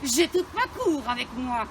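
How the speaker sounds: booming royal voice; hammy stage actor. Acted. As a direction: declaim like a pompous king. Plays Henri IV as king.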